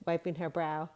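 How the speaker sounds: ordinary delivery, nothing marked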